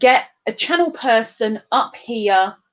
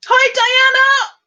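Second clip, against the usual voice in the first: high, loud, stretched hello; big high-pitched shout of welcome; shouted greeting; 6 dB louder, slower than usual. Acted. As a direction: holler it back across the room. Shouted reply contrasting the little hi.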